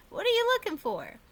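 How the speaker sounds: put-on high, quick little-girl voice; eager kid asking at dawn; high and quick, like a curious little sister.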